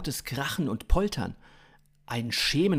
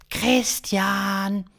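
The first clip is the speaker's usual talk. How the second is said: high, loud, drawn-out alien voice; slow high-pitched extraterrestrial; alien stranger; 9 semitones higher, 6 dB louder than usual. A narrator's reading. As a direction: stretch the word out in a high alien call. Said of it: "erklärte der Außerirdische".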